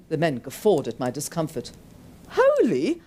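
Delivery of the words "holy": high, loud pained yelp. Acted. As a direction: yelp it out through the pain. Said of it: "I yelped through my pain".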